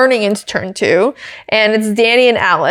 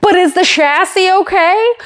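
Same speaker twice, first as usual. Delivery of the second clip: mock commentator; 8 semitones higher than usual. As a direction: ask it like a fussy commentator. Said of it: "the commentator goes".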